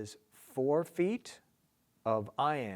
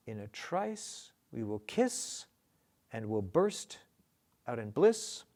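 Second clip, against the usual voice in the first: pauses after "trice", "kiss", "burst", each stressed and rising; marking the accents. Shown as a demonstration. Teacher marking accents, rising anapestic beat. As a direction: lean on every third syllable: "trice", "kiss", "burst", "bliss". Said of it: "So mark the accents".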